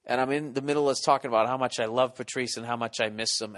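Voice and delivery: ordinary talk, nothing marked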